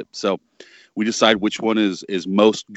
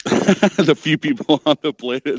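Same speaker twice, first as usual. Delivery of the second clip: laughing echo; 6 semitones higher, 4 dB louder than usual. Real, not acted. Guest laughingly echoes host's phrase.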